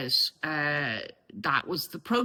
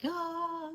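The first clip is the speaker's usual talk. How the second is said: high, soft sung "aah"; angelic choir "aah"; 13 semitones higher, 8 dB quieter than usual. Retold or imitated. Speaker showing her realisation as angels singing, high soft note. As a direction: imitate angels singing one held note. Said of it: "the angels started singing".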